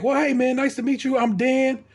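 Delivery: imitating an upbeat young neighbor introducing himself; friendly neighbor. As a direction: greet him brightly like a friendly guy. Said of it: "the guy's like".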